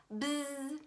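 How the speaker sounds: soft imitated buzzing of a dental polisher. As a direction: hum it like a tiny drill. Dentist's polisher starts buzzing.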